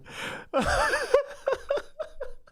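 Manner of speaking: shrieking laughter, way up high; real laughing fit at the parody clip; long high laughing fit. Real, not acted. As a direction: lose it laughing. Reacting to parody, cracking up.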